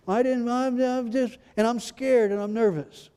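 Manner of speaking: high, fretful mock voice; mock anxious. Preacher mimicking people afraid to die, mildly anxious. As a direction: imitate a nervous dying person.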